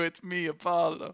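put-on half-hearted Italian accent echo; throwaway mock Italian accent; lightly voiced Italian. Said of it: "if Jared Leto doesn't do".

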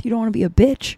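ordinary talk, nothing marked

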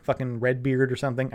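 ordinary talk

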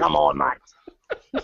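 drawn-out Aussie drawl, slow; hammed-up slow Australian accent; thick Australian accent, very slow. Shown as a demonstration. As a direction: stretch every vowel like a stage Aussie. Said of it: "sound like a pirate".

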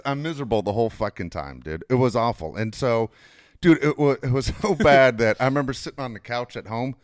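ordinary talk

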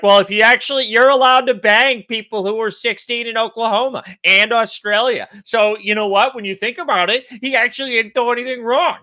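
put-on voice of an indignant online commenter; mock internet apologist. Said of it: "people on the internet being like".

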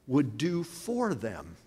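normal talking voice

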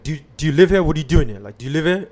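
a mock interrogation at the door; retold nosy neighbour. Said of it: "And she's like".

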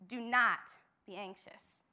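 firm command leaning on "Do not"; stress on do not. Shown as a demonstration. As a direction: punch "Do not" like an order. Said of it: "This is a strong command".

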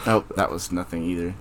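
usual speaking voice

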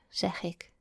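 ordinary delivery, nothing marked

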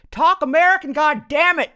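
high, very loud angry yell. A narrator's reading. Dad shouts at boyfriend's mom in restaurant, yelling. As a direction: explode at full volume. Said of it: "yelled Laura's dad".

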